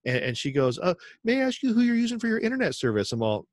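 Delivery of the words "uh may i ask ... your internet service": 2 high sing-song sales pitch voice; 3 imitate a chirpy internet sales rep; 1 mock saleswoman. 4 saleswoman pitching internet service to him, high sing-song.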